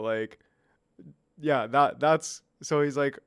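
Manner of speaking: usual speaking voice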